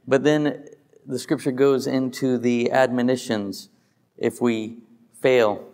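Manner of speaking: ordinary delivery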